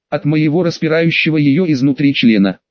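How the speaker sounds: usual speaking voice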